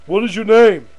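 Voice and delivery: demanding, building to a loud high repeat; bullying cop. Acted. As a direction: press the question harder until it is nearly shouted. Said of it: "cops that think they're top shit".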